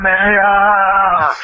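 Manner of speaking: high, drawn-out anime attack cry; long, stretched-out battle cry; imitated yell. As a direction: yell it like Goku firing off a blast. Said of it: "and he yells out".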